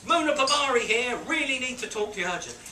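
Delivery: high, loud message read-out; urgent pager message. Retold or imitated. Pager relays stranger's third plea, high loud urgency. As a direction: push the plea out like an announcement.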